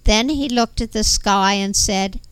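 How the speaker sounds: ordinary talk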